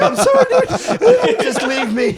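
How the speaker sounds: very high, loud, helpless laughing plea; crying laughing. Retold or imitated. Re-enacting his high self telling a friend to leave him, crying laughing. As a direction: beg through uncontrollable squeals of laughter. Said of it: "I was fucking crying laughing".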